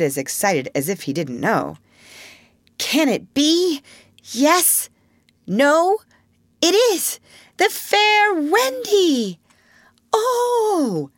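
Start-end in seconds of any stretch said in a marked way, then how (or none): 2.8-9.4 very high, slow, breathless suspense; dramatic reveal. Acted. Wendy's dramatic reveal in story. 10.1-11.1 very high, long "oh" of wonder; children's awed cry; amazed "oh". Acted. Children gasp at the reveal.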